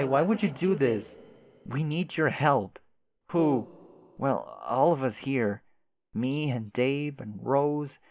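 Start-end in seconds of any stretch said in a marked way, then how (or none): none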